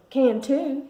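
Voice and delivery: high, loud taunting retort; countered. A narrator's reading. Brother arguing with his sister at dinner, loud and high. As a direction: fire it right back at her. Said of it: "he countered".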